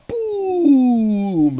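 high, loud boom; a sudden, high, loud boom sound effect; mock explosion. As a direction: burst out the impact like a crash. Meteorite crashing in desert.

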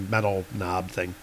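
plain reading voice

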